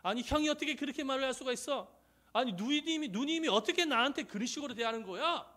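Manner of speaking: acted outburst of hurt indignation; indignant confrontation. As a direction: argue back at your brother and sister. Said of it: "막 따지면서 막 대들 수도 있겠지요".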